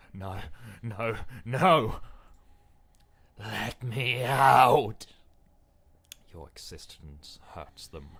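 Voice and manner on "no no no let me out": loud, higher, slow desperate yell; a trapped soul screaming to get out; panicked scream. Acted. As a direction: scream to be freed. Trapped voice begging to escape.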